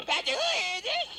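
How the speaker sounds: high, loud cracking yell; furious shout. Acted. Plumber pounding the door yells, voice shooting up. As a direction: scream it at the door.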